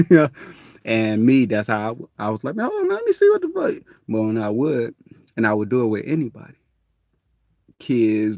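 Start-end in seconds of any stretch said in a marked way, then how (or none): 2.5-3.8 very high, fast; startled kid. Retold or imitated. His younger self wanting to look, very high and fast. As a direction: squeal it quickly.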